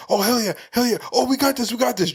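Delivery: hyped up, pitch climbing; excited. Retold or imitated. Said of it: "I feel like they were like".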